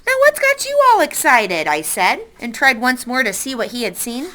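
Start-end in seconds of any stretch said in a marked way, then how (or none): 0.1-1.7 much higher, louder puzzled call; surprised boy. Acted. Boy asks the frightened raccoon, high surprised call.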